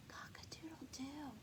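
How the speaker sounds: a tiny breathless whisper of a crow. Acted. Rooster losing his voice, whispered crow. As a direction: rooster trying to crow but only whispers.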